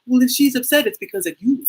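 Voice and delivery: lightly put-on Kenyan accent. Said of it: "I'm just trying to do an accent for you".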